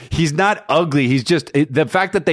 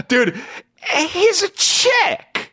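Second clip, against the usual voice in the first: laughing; much slower than usual. Real, not acted. Mocking Fabio's looks.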